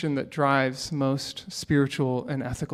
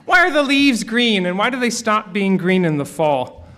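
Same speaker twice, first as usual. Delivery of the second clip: put-on higher, louder childlike voice asking why; curious four-year-old; 4 semitones higher, 6 dB louder than usual. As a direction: play an eager little kid pestering with questions.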